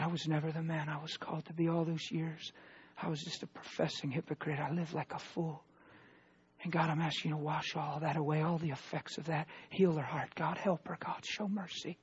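soft prayer. Retold or imitated. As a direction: pray gently and quietly for her. Retold tender prayer for wife.

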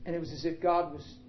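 usual speaking voice

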